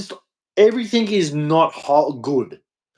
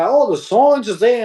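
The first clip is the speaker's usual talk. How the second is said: mocking quote; 7 semitones higher than usual. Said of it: "when you hear people say".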